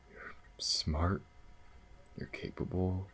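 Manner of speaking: soft, whispered praise; tender whisper. Acted. Tenderly whispering praise to partner. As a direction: murmur it close and tender.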